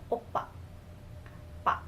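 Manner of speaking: "oppa", then "pa" alone, with a slight B; light B sound in "pa"; toned-down B. Shown as a demonstration. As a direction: model "oppa" and "pa" without an aggressive B. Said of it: "tone it down a little".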